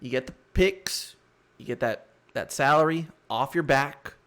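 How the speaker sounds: ordinary talk, nothing marked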